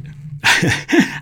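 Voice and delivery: quick airy laugh at his own joke; a short breathy chuckle. Real, not acted. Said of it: "I'm laughing just thinking about it".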